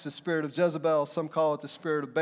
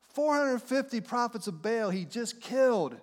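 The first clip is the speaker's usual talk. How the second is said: usual speaking voice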